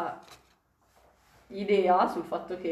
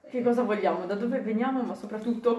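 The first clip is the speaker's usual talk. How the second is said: normal talking voice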